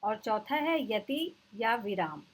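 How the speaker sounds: usual speaking voice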